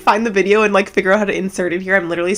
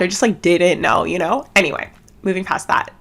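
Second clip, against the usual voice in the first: ordinary delivery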